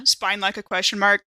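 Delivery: normal talking voice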